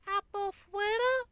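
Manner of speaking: put-on very high babyish garble; tiny foal asking for food; garbled baby voice. A narrator's reading. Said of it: "the baby asked in a garbled accent".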